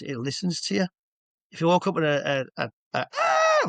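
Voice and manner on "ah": a sudden high, loud panicked "Ah"; panicky yell. Shown as a demonstration. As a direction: shriek it like someone losing control.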